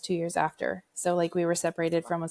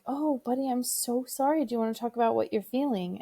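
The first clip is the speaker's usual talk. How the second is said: higher, gentle consoling mom; tender comfort; 5 semitones higher than usual. Retold or imitated. Mother comforting her sad son in bed, tenderly. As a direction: soothe a sad child. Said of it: "And I was like".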